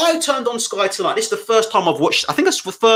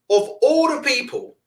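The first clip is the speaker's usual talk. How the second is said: ordinary delivery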